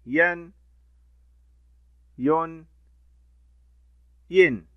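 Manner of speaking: ين يون ين as "an, un, in", each loud and short; tanween sounds. Shown as a demonstration. Teacher reciting the three tanween sounds to children, loud. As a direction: run through the three nunation endings. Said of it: "ثلاثة أنواع للتنوين".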